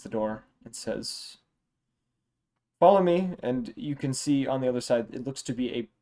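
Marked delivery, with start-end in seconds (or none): 2.8-3.3 guide's command. Acted. Golden Dragonborn speaks.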